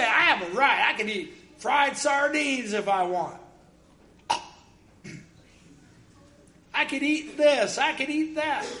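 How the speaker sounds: insistent entitlement. Acted. Mimics entitled believers claiming rights.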